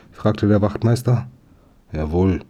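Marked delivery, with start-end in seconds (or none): 1.9-2.4 lower, glum short answer; gloomy. A narrator's reading. Thief admits the theft to the sergeant, gloomy. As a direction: say it darkly, pitched down. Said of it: "sagte der Dieb düster".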